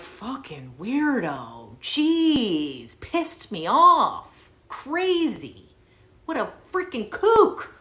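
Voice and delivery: drawn-out grumbling, climbing high; exasperated disgust. Retold or imitated. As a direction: vent after kicking someone out. Grumbling after he is thrown out.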